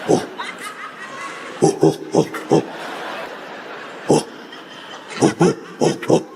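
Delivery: breathy, voiceless gorilla hooting; imitated gorilla panting "oh, oh"; gorilla hoots. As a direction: puff out short ape hoots in bursts. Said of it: "Starts morphing into a fucking gorilla".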